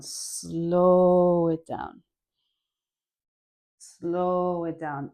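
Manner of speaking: very slow, drawn-out words. Shown as a demonstration. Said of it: "let's slow it down".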